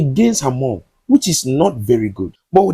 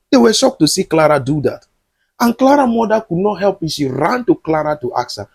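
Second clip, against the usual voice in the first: ordinary talk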